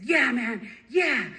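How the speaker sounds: loud, emphatic yes; loud, eager assent; fired-up agreement. Retold or imitated. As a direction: agree with rising fervour, nearly shouting. Second answer, escalating to a yell.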